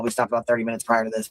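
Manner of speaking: ordinary delivery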